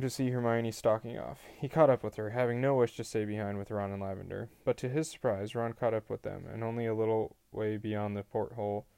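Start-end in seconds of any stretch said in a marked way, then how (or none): none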